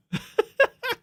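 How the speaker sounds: sharp, high burst of laughter. Real, not acted. Streamer laughing at his own sarcastic joke, high laugh. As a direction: cackle at the joke.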